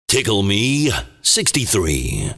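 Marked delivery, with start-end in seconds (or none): none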